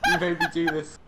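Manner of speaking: blaming through giggles; laughing. Real, not acted. Laughing while blaming his friend.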